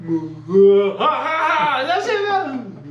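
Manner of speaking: high, loud mock cackling laugh; imitated party laughter. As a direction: ham up the crowd laughing downstairs. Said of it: "I hear waves of".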